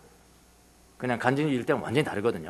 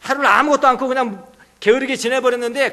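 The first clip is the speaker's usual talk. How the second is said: ordinary delivery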